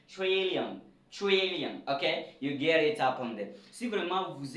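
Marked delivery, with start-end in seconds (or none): 0.1-1.8 "trillion" higher and punchy, with rounded "chr"; English pronunciation. Shown as a demonstration. Teacher models the corrected word for learners, emphatic English pronunciation. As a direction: model "trillion" as "CHRIL-yan", falling sharply. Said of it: "Ce n'est pas tri".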